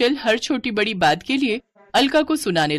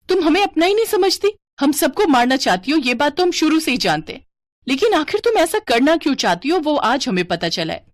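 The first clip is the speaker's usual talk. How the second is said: high-pitched confrontation; angry accusation; 7 semitones higher, 3 dB louder than usual. Acted. Family member angrily accuses the wife. (गुस्से में ऊँचे सुर का आरोप)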